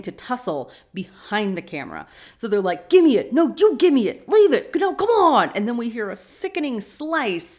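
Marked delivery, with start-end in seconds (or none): 2.9-5.5 high, loud squabbling back and forth; mock arguing. Sam and Dean tussling over the axe, loud mock squabble. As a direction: bicker at a shout, hammed up. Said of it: "Sam and Dean arguing".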